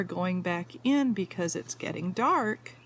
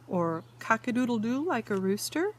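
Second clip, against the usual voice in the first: ordinary delivery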